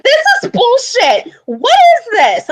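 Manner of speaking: high, whiny tantrum shout; mock outraged tantrum. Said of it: "having a full-on tantrum".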